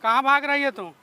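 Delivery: loud scolding shout; angry shout. Real, not acted. Brother shouts at the wife who is running away. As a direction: yell it at her as she runs.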